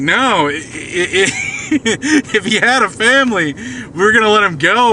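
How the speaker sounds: high-pitched, stammering protest broken by laughter; laughing protest. Retold or imitated. Player telling the GM they'd have let him go, laughing protest. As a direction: retell an objection while cracking up.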